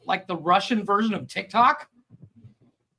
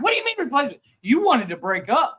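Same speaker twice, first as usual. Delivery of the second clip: put-on high, indignant girlfriend voice; mock outraged disbelief; 7 semitones higher, 3 dB louder, faster than usual. Girlfriend confronting boyfriend over her replacement, mock outrage. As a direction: imitate a girlfriend protesting, pitched up.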